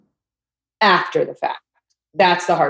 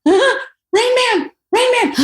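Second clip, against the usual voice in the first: teasing high call; 14 semitones higher, slower than usual. Retold or imitated.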